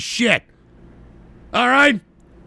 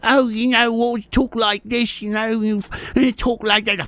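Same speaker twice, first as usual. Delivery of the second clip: mock British accent. Host mocking British complainers, stupid British accent. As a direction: imitate a Brit with a silly accent. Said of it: "they have that stupid accent".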